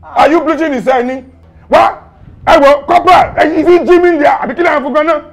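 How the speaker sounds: loud, high indignant rant; outraged scolding. Acted. Officer ranting at prisoner looking fresh, outraged scolding. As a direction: berate the prisoner in disbelief.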